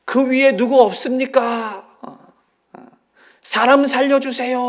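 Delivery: high, loud, slow cry for help; desperate call upward for help. Retold or imitated. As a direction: shout up the cliff for rescue. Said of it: "곧바로 소리치기 시작을 했습니다".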